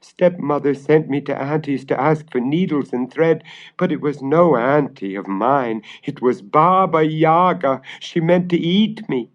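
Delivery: put-on upset girl. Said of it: "sobbed Marusha".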